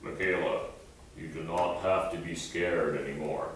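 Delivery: low, soft and solemn divine voice; deep voice of God. Retold or imitated. Pastor asking the girl if God spoke like this, low and soft. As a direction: speak as a gentle God reassuring her. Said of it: "did you say it like this?".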